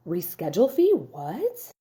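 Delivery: mock outraged question, rising high; imitated indignant disbelief. Client hearing about the reschedule fee, mock indignant disbelief. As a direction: echo the fee as if appalled. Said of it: "She did not like that".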